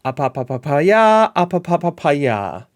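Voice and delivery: lightly voiced, slow mock Mourinho chant; a hint of Mourinho, drawn out slowly; Mourinho impression. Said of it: "like Mourinho in the Tottenham documentary".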